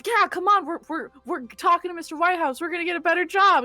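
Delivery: high, fast and eager; guard hurries his brother to the boss, high and fast; excited rally. Acted. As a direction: urge him along, all fired up.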